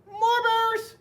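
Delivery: put-on high, loud kids' shout; high-pitched holler of boys and girls; children yelling. A narrator's reading. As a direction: yell it like an eager crowd of kids. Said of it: "sounded just like boys and girls".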